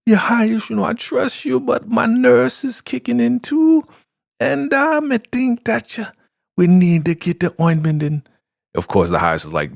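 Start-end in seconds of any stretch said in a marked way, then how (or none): 0.1-8.2 much higher, insistent pleading voice; pleading Elder Mother. Retold or imitated. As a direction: squeak out the plea for medicine.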